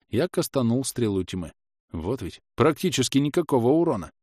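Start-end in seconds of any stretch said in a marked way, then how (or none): none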